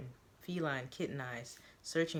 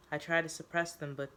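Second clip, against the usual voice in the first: ordinary delivery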